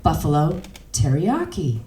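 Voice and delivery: plain reading voice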